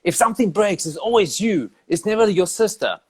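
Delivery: lightly voiced nagging mother; scolding mom. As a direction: play the mom blaming her son. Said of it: "my mom used to tell me".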